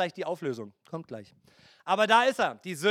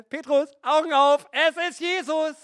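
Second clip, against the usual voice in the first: higher and louder, eager; excited call; 8 semitones higher, 5 dB louder than usual. Acted. Beloved disciple calling Petrus on the boat, excited. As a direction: call Petrus with sudden joy.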